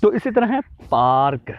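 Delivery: ordinary talk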